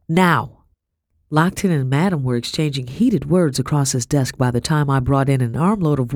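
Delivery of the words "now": one stern, emphatic command. A narrator's reading. Mistress hurrying the servant girl, stern. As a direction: order it done at once. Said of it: "she said sternly".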